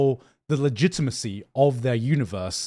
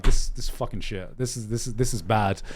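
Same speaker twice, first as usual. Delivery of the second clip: low, quiet imagined grumble; hushed, deflated mock complaint; mock disgusted muttering; 6 semitones lower, 5 dB quieter than usual. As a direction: grumble it under your breath, unimpressed. Imagines his own disappointed reaction.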